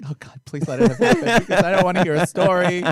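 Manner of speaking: hammed-up anxious begging, rushed; mock pleading. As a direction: beg through laughter. Retold anxious plea about the proposal.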